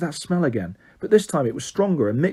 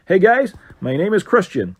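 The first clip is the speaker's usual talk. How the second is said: put-on loud, upbeat American accent; 5 semitones higher, 4 dB louder than usual. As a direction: greet them like an American tour guide. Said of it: "he said in an American accent".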